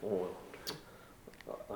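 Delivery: ordinary talk, nothing marked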